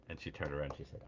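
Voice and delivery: normal talking voice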